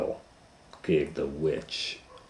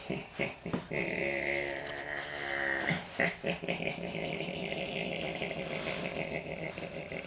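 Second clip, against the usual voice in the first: low, soft, long cackle; drawn-out witch laugh; 4 semitones lower, 10 dB quieter than usual. Acted.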